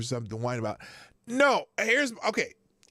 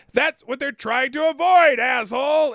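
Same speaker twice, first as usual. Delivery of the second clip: loud, high exasperated yell; real shout; 10 semitones higher, 11 dB louder than usual. Real, not acted. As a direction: blurt it out loud in disbelief. Laughing outburst at the sign.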